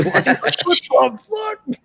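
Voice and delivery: high, very slow disbelieving drawl; mock baffled. Velvet Sky baffled by yelling in Spanish, high slow drawl. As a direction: stretch it out in bewildered disbelief.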